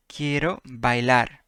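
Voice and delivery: slow, careful "Quiero bailar" with each syllable clear; careful classroom repeat; slow model repeat. Shown as a demonstration. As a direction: say "Quiero bailar" slowly for learners to copy. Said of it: "slow repeat for learners".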